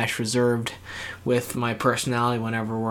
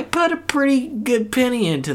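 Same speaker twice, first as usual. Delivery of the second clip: high squeaky voice; 13 semitones higher, 4 dB louder than usual. Acted. Playful high voice on puppy.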